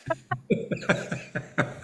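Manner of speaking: high, breathy laugh; quiet giggle in a quick run. Real, not acted. Reader to friends after finishing the poem, laugh.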